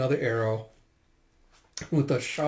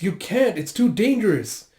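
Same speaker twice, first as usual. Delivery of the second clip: high, worried objection; alarmed protest; 7 semitones higher, 4 dB louder than usual. Retold or imitated. Warning against going back to help, alarmed. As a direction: plead against it urgently.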